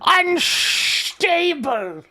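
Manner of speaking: very slow, stretched-out "unstable"; drawn-out punchline. Real, not acted. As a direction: drag the word out for comic understatement. Wry euphemism for crashing software.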